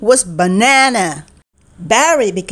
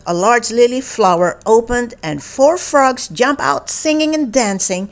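ordinary delivery, nothing marked

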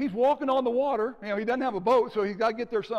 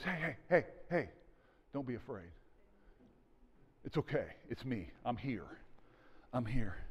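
much softer, lower soothing voice; reassuring Jesus; 9 semitones lower, 10 dB quieter than usual. Retold or imitated. Jesus quietly reassuring his frightened followers, soft and low. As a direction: hush them gently like calming a scared child.

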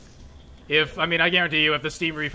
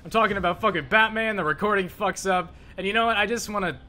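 agitated, pitched-up rant; mad, worked up; 5 semitones higher, 5 dB louder than usual. Real, not acted. Host upset about Batman and the recording, mad rant. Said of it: "I'm really mad right now".

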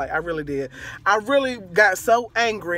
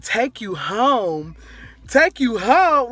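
indignant, drawn-out echo of his request; mad retort; 5 semitones higher, 4 dB louder, much slower than usual. Retold or imitated. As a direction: throw it back slowly, louder the second time. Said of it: "I'm mad".

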